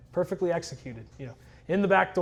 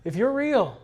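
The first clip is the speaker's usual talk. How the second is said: slow, pleading, a little louder and higher; calling out slowly to a God he doubts; earnest prayer; 5 semitones higher, 5 dB louder, much slower than usual. Retold or imitated. Alone in the car, praying to God, slow and earnest. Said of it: "I prayed to this God".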